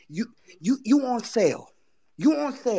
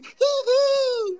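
mock giggle; 13 semitones higher than usual. Mocking rival in reaction rant.

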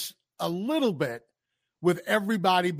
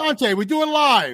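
higher, louder insistent call; mock outrage; 4 semitones higher, 5 dB louder than usual. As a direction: protest loudly to the doubter. Calling out a doubting chatter.

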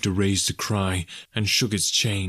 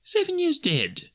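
high, slow pondering; musing; slower than usual. A narrator's reading. Scrooge working out Marley's years dead, musing. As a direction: think aloud in a high voice. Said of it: "mused Scrooge".

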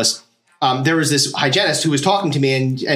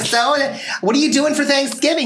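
chatty hygienist; 10 semitones higher than usual. Retold or imitated.

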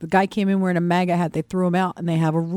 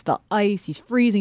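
normal talking voice

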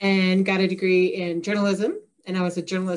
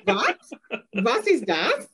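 hammed-up German with a questioning lift; mock German, W turned to V; bad fake German accent; 8 semitones higher, slower than usual. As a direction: playfully put on a terrible German accent. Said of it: "this fake German accent really badly".

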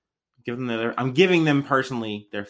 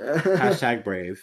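sarcastic slow drawl; 2 semitones lower, much slower than usual. Pretend. Sarcastic mock praise.

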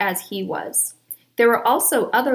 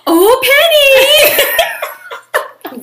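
dog voice; 10 dB louder, faster than usual. Retold or imitated. Daughter quoting her mom's dog voice, high loud croon. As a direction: call the dog's name like the mom does.

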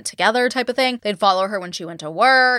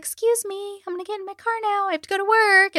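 polite sweet voice; 11 semitones higher than usual. Retold or imitated. Diana asking the paparazzi horde to let her reach her car, high and sweet. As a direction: chirp it politely to the crowd. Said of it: "she's like".

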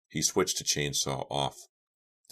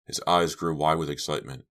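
ordinary delivery

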